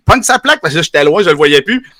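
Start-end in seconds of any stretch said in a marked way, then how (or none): 0.1-0.6 high-pitched call across the street. Retold or imitated. The speaker calling to a neighbour to grab the plate, a high call. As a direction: shout it to someone far away. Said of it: "il crie".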